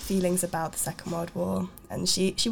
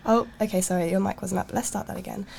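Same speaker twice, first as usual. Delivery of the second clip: ordinary delivery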